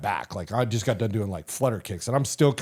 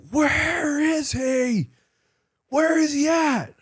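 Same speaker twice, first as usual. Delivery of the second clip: high, slow hollering voice; mock yelling search; much slower than usual. Tab Spec fours hunting the new guy, hollering high. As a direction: bellow down the hall hunting him. Soldiers searching hallway for him.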